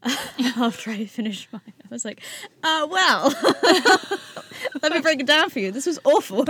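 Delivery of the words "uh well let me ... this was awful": high, loud retold outburst through laughter; laughing outburst. Patient telling the group about a dreadful meal, laughing loudly. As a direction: blurt it out loudly, breaking into laughs.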